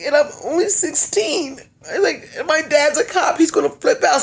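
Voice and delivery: high, put-on little-girl whimper; fake tearful victim. Scaring him with her age and cop dad, fake tearful. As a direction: plead as if upset, clearly faking.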